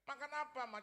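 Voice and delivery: high, loud demand; insolent challenge. Acted. Son challenging his mother, insolent. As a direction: demand an answer loudly. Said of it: "Kurang ajar sama ibu saya".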